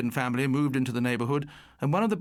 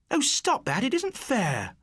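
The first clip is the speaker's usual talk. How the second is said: high, pleading protest; a girl crying out, much higher; 10 semitones higher than usual. A narrator's reading. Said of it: "Pamela cried".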